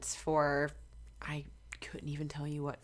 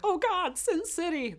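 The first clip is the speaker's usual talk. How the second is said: much higher, louder gasp of disapproval; mock scandalised; 13 semitones higher, 5 dB louder, slower than usual. Singer mimics outsiders judging Vegas, mock scandalised. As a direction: clutch your pearls.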